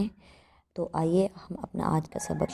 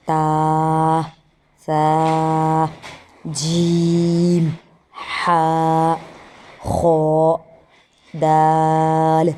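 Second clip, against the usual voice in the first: louder, steady chanting of the letters; loud recitation drill; 7 dB louder, much slower than usual. Shown as a demonstration. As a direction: recite each letter clearly for the class. Recites the alphabet drill aloud.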